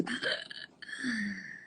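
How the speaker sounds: sharp, amazed intake of breath; awed gasp. Real, not acted. Guest gasping at her dream coming true. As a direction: gasp as if it's all too much.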